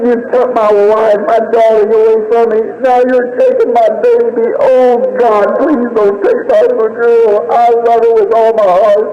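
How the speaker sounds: high, sustained grieving plea; anguished prayer. Retold or imitated. Father praying for his dying child, anguished pleading. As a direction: beg God with a breaking heart.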